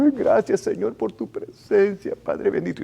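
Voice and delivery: mock weepy worshipper; imitated tearful prayer. Worshipper thanking God for his presence, imitated crying voice. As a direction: imitate someone praying through tears. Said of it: "una persona está llorando".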